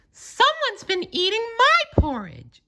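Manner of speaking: very high, put-on Mama Bear voice; a mother bear noticing her bowl, voiced far up. A narrator's reading. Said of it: "she said".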